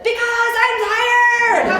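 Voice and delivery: high, drawn-out mock yell; screaming back. Daughter yelling back at her mother, imitated scream. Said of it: "I screamed at my daughter".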